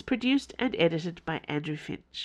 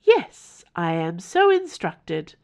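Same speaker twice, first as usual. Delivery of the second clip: creepy formal house; 5 dB louder, slower than usual. Acted. The house informing Mother in a creepy formal tone. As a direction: announce it like a cold formal servant.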